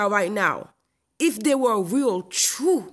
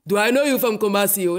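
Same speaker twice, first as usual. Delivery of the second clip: mock Ghanaian accent, a louder repeat with a changed voice; imitating Ghanaian accent, louder; 4 dB louder, faster than usual. Ghanaian stranger calling after her in the street, louder mock Ghanaian accent. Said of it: "they stop me and they say".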